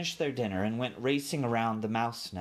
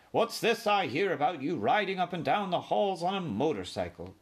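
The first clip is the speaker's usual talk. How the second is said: higher, hearty uncle voice; blustery mouse uncle, pitched up; tactless uncle; 7 semitones higher than usual. A narrator's reading. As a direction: boom a nosy question at the nephew.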